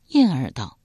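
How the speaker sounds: ordinary delivery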